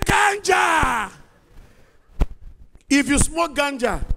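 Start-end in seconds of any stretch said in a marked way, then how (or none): 0.0-1.1 shocked shout. Acted.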